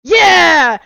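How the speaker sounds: high, explosive shout; hammed-up hype-man yell; imitating a loud pitch. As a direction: burst out with over-the-top enthusiasm. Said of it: "Chris was like".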